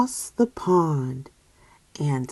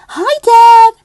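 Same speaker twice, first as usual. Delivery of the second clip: very high, loud call; hungry kid shouting hi; excited greeting; 13 dB louder than usual. Acted. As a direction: holler hello to dad.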